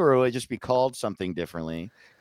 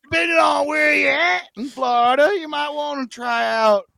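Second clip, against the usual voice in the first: Google South voice; 11 semitones higher than usual. Pretend. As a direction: sell the tacos like a good ol' boy.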